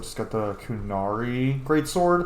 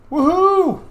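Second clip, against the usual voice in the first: high, loud victory whoop; cheering woohoo; 10 dB louder than usual. Real, not acted. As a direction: whoop with joy as your friend returns. Cheering as companion rejoins.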